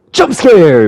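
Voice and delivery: sudden loud high shout; mock jump scare. Batman suddenly appearing in the game, loud high shout. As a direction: burst out as if springing on someone.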